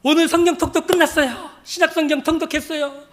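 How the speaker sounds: high, loud triumphant announcement; imitated jubilant phone call; overjoyed. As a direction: burst out with joyful news. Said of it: "하고 기뻐가지고 저한테 전화가 온 거예요".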